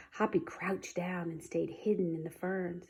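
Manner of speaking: ordinary delivery, nothing marked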